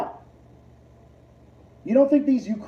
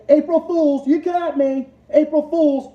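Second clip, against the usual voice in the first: loud, high taunting April Fools; mock April Fools shout; 8 semitones higher, 6 dB louder than usual. Streamer taunting his chat, loud and high. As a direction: yell it out like a prank reveal.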